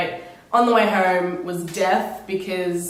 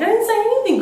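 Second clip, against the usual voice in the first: much higher, louder protest in Italian; panicked denial; 14 semitones higher, 5 dB louder, faster than usual. Retold or imitated. Vlogger retells protesting her innocence to the stranger, high and loud. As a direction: squeak out your innocence. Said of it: "And I was like".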